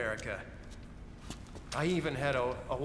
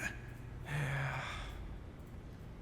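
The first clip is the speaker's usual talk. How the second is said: long soft wistful sigh; quiet drawn-out sigh; 4 semitones lower, 10 dB quieter than usual. Acted. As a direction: sigh out the longing. Guard missing his family.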